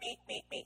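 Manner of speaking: very high squeaky peeps; high little meep meep meep; tiny bird meeps. Acted. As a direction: chirp like a tiny excited sparrow. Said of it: "it sounded like this".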